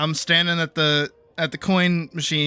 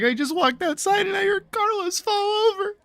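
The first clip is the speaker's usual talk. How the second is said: laughing. Real, not acted. Retelling while still laughing.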